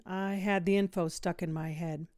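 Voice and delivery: plain reading voice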